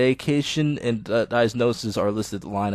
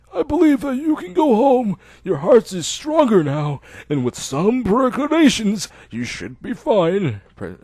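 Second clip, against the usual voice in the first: put-on higher, louder, measured old doctor; 8 semitones higher, 4 dB louder than usual. Old doctor telling Hisao he can go home.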